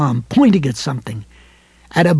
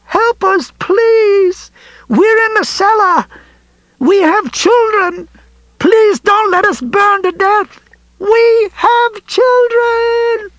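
high, slow screaming for help; terrified screaming plea; 4 dB louder, slower than usual. A narrator's reading. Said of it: "It was Helen Deepno's voice".

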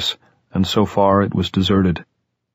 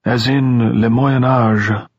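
slow, drawn-out musing, pitched up; dreamy scholar lingering on the French; almost dreamy; 4 semitones higher, much slower than usual. A narrator's reading. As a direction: say it as if lost in reverie. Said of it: "He spoke almost dreamily".

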